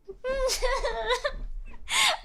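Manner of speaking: high-pitched giggle breaking out; helpless high laughter; real nervous laugh. Real, not acted. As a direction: burst into a high, drawn-out giggle. Breaks character after the story.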